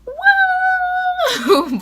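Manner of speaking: loud, very high whoop; high cheer shout. Real, not acted. Vlogger cheering seniors off with a loud high woo. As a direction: let out a big celebratory whoop.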